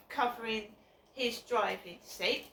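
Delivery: ordinary delivery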